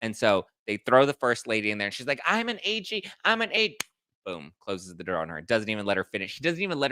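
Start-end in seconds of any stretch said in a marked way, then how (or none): 2.3-3.8 high, cut-off protest of her title; mock indignant squeal. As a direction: squeak it up in outrage. Woman shouting her title.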